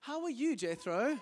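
a sing-song how are you; bright high call to a friend; cheery greeting. Acted. Acted exchange with Jethro.

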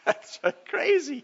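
high, breathy laughter into slow words; laughing. Real, not acted. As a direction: laugh it out in delight. Laughing at God's choice.

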